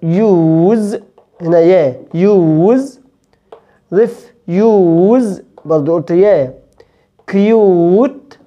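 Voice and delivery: كيوت said slowly as "kyoot" with a clear "y"; "yoo" sound. Shown as a demonstration. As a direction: put a clear "y" glide before "oo" in يوز، ريفيوز، كيوت، تيوب، تيون، هيوج. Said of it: "هنطقها أو وقبلها يا". (نطق يو مع يا قبلها)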